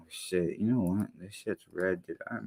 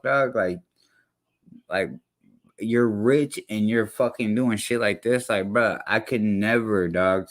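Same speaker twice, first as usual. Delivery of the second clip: normal talking voice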